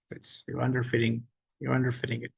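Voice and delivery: usual speaking voice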